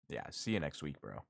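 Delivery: low, soft acted aside; muted goodbye. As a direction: say it under your breath, low. Acted muted goodbye to departing coworker.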